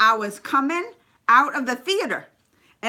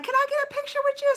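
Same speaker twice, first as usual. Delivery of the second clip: eager request; 14 semitones higher, 4 dB quieter, faster than usual. Retold or imitated. Asking a star for a photo.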